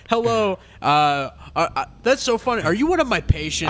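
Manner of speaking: hammed-up doctor greeting a patient; doctor talk. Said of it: "How would a doctor talk?".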